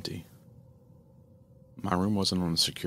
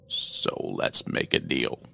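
very slow, low raspy voiceless demon; menacing demon; 7 semitones lower, much slower than usual. Acted. Shadow demon telling the mother why he came, slow menacing rasp. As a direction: draw each word out like a shadow.

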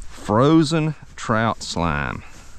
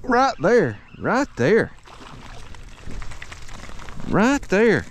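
excited; 11 semitones higher, 4 dB quieter than usual. Real, not acted. Fish swimming to the boat.

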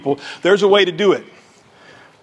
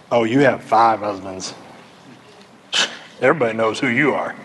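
snarky; 7 semitones lower than usual. Acted. Wrong reading of Jesus judging the woman, snarky. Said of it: "Jesus might have been being snarky".

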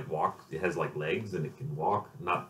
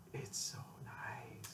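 whispered; 18 dB quieter, much slower than usual. Real, not acted. Blissful memory of business class.

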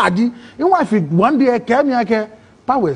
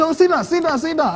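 urging; 9 semitones higher than usual. Retold or imitated. Drivers urge him to sit.

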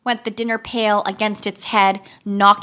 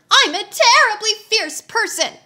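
much higher, whiny boast; whining wheeler; 12 semitones higher than usual. A narrator's reading. Captured wheeler boasts of fierceness, high whine. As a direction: puff up in a squeaky whine. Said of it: "whined the wheeler".

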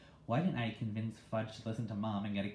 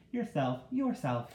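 high parroting bird, higher; bird repeating; 5 semitones higher, 4 dB louder, slower than usual. Acted. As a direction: squawk it back like a mynah. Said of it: "he repeated".

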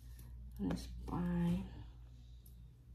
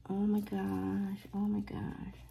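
ordinary talk

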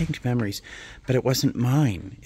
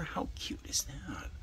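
softer, hushed whisper of delight; 5 semitones higher, 12 dB quieter than usual. Real, not acted. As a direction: whisper it to yourself, charmed. Whispered delight over planter.

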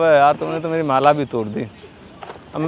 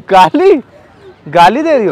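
indignant disbelief; 3 semitones higher, 6 dB louder than usual. Real, not acted. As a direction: throw it back loudly, affronted. Prankster reacts to being sworn at. (हैरानी भरी ऊँची आवाज़ में सवाल)

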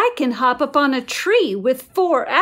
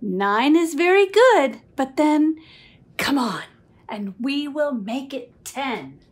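plain reading voice

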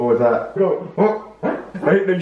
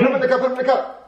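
high, frantic repeated order; panicked urging; 9 semitones higher, much faster than usual. Acted. Wiggly fish needs the cup, panicked urging. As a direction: urge it up high. Acted urgent order, second take.

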